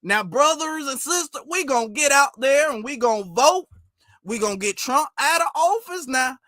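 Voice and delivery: high-pitched mock Southern stump speech; hammed-up Southern accent, rallying the brothers and sisters; fake Southern accent. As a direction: imitate a put-on Southern orator. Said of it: "that's not a Southern accent, baby".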